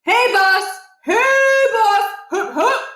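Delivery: high, loud singsong cow call; hollering hey boss up high. A narrator's reading. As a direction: call the cows across a dark field. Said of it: "His voice was startling in the blackness".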